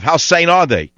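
ordinary talk

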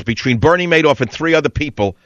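normal talking voice

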